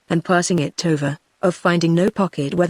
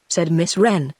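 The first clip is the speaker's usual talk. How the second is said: usual speaking voice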